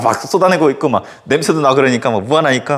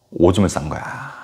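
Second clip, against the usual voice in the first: ordinary talk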